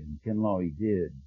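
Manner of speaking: hushed and low, barely above a breath; softly recalled answer; very quiet. Retold or imitated. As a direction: let it drop to a near hush, like a sacred memory. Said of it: "And very quietly Joseph said".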